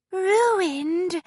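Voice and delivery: ordinary delivery, nothing marked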